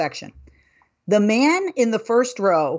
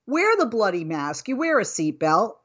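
normal talking voice

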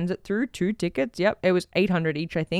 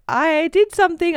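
higher, coy confession; teasing reveal; 7 semitones higher than usual. Retold or imitated. Coy reveal to wife coming home, higher pitched. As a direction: dangle the secret.

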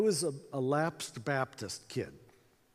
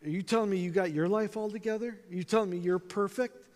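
higher, indignant challenge; offended counterattack; 4 semitones higher than usual. Retold or imitated. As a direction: throw the accusation back at him, offended. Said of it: "I'm offended. I'm mad".

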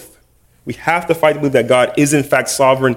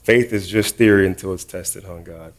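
low, much softer, sheepish admission; 6 semitones lower, 6 dB quieter than usual. Retold or imitated. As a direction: mumble it low, embarrassed. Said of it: "I was like".

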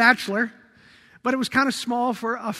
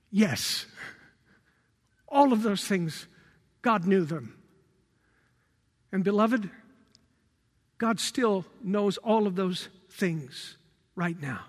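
tender reassurance; 4 semitones lower, 5 dB quieter than usual. Acted. Pastor comforting beloved listeners, low and tender. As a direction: settle them gently after the storm. Tender answer after climax.

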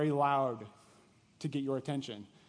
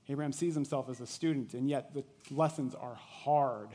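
normal talking voice

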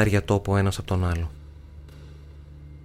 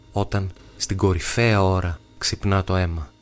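usual speaking voice

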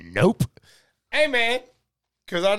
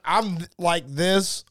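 usual speaking voice

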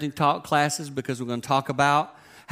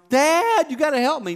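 much higher, louder whiny plea; begging son; 13 semitones higher, 5 dB louder than usual. Retold or imitated. Son begging dad for help, high whine. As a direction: beg like a desperate teenager. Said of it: "son begs again".

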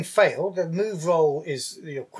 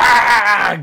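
loud, drawn-out yell, higher pitched; attack yell; 6 semitones higher, 10 dB louder than usual. Acted. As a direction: roar out a long "ah" as the attack lands. Acting out the wolf's attack.

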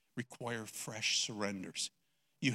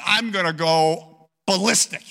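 much louder, blown-up declaration; exploding outburst; 6 semitones higher, 13 dB louder than usual. Retold or imitated. Grandpa telling his wife he'll lose it, loud. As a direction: let it burst out of you.

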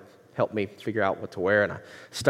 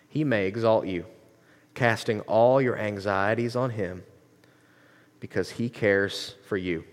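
slow, deliberate scripture reading with long pauses; unhurried, measured reading, phrase by phrase; super slow; 3 semitones lower than usual. Shown as a demonstration. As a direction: read it slowly, letting each word sink in. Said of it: "super slow".

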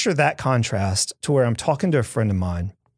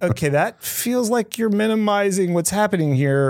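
exasperated inner reply; 8 semitones higher than usual. Retold or imitated. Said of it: "I'm like".

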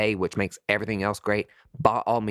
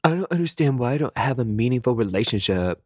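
mock whine. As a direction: pity yourself, hammed-up. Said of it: "then you hear".

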